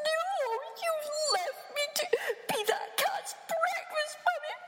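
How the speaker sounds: very high indignant chirp; upset baby bird. Acted. Chick scolding Mummy, high indignant. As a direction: complain like a hurt little chick.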